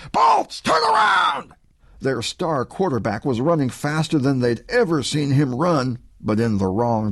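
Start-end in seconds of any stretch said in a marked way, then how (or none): 0.1-1.5 high, loud bellow across the field; coach shouting. A narrator's reading. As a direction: yell him back. Coach yells at fleeing quarterback.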